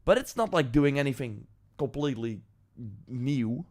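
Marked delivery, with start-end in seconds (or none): none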